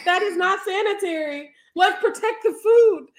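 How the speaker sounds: high, laughing fake indignation; laughing mock outrage. Fussing at cyborgs invading the kitchen, laughing high. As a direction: squeal it out, cracking up.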